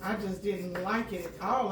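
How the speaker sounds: ordinary talk, nothing marked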